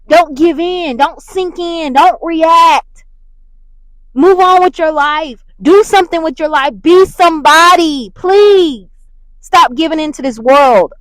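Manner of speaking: loud, high exhortation like a pulpit call; preaching at full force. Acted. Urging listeners not to react, loud preaching. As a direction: preach it hard at them.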